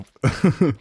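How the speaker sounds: high, loud laugh breaking off speech; giggle. Real, not acted. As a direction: dissolve into a short giggle. Laughing before doing Elmo voice.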